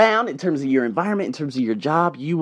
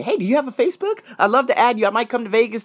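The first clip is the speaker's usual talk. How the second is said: higher, sweet imitation of the friendly lady; the Russian dentist asking to add him, mildly imitated; 8 semitones higher, faster than usual. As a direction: lightly voice her cheerful invite. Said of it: "She ended up saying".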